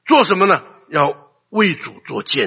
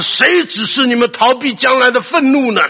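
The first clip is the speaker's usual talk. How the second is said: stern rebuke; 9 semitones higher, 6 dB louder than usual. Retold or imitated. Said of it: "他说".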